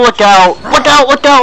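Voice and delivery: sharp rising calls of alarm; urgent ringside alarm; excited warning. Real, not acted. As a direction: shout the warning up high. Commentator warning of incoming hit.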